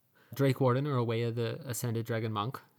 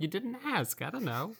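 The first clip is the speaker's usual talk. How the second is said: higher, offhand innkeeper; shrugging innkeeper; 9 semitones higher than usual. Retold or imitated. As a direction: brush it off, lightly higher. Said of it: "And she's like".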